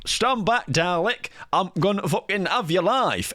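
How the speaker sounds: lightly voiced Scottish Tennant; mock Scottish Doctor. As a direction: ham up a Scottish Time Lord. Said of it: "David Tennant and that was like".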